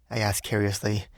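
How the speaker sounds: usual speaking voice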